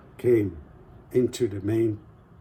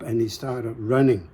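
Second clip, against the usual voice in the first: usual speaking voice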